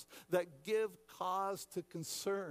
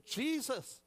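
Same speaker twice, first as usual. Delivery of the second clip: pleading disciples; 7 semitones higher, 4 dB louder, slower than usual. Acted. Disciples wake Jesus in storm.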